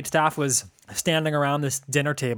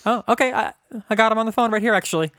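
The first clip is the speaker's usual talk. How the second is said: hammed-up host on the phone, higher and fast; mock talk-show host; 7 semitones higher, 3 dB louder than usual. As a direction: play a cheesy interviewer. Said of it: "[name] Improv Corner".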